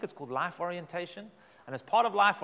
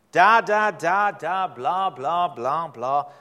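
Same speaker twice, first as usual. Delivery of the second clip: loud sing-song da da blah blah; loud mocking babble; mocking baby talk; 10 dB louder, much slower than usual. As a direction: mimic nonsense baby babble. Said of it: "God will speak to this people in baby talk".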